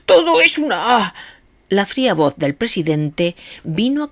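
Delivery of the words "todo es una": frantic shout. A narrator's reading. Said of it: "gritaba Gregory frenético".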